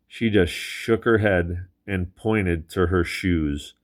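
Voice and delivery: usual speaking voice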